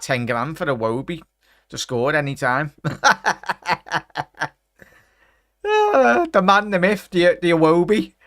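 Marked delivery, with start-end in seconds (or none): 2.8-4.5 real delighted laugh; a short, higher laugh at the goal. Real, not acted. Laughing after surprise goal. 5.6-8.1 much higher, louder mock-reverent praise; real excitement, high and loud; excited hail. Real, not acted. Hailing the scorer after goal.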